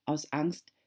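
ordinary delivery

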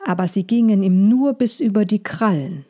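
ordinary delivery

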